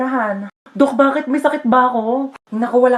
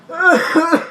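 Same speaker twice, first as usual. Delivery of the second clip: high, exaggerated little cough; fake sick cough; 7 semitones higher than usual. Child dodging school, put-on cough. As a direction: cough to prove you're ill. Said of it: "Masama po pakiramdam ko".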